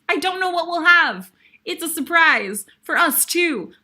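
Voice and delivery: bubbling over about the surprise; gleeful excitement about next week; excited. Real, not acted.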